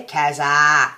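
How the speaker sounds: loud Aussie nickname with a drawn-out final vowel; broad Aussie accent. Shown as a demonstration. Vlogger showing a friend how to say her nickname, broad Aussie accent. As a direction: punch out "Kazza" in an Aussie accent. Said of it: "say it like that with an Aussie accent".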